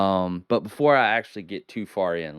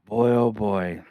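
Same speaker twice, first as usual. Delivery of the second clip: ordinary delivery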